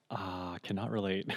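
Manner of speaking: unimpressed self-quote. Retold or imitated. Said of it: "And I'm just like".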